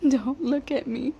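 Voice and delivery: slow words through tears; real tearfulness. Real, not acted. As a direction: brush off the onlooker while composing yourself. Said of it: "cry again".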